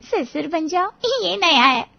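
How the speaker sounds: high-pitched, tickled repetition; amused echo. A narrator's reading. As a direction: repeat his outburst as a funny novelty. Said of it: "फिर अचानक हंस पड़े". (मज़े लेकर ऊँची आवाज़ में दोहराना)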